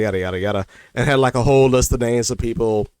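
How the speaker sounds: usual speaking voice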